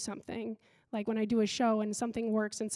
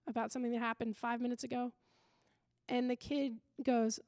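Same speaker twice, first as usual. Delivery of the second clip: normal talking voice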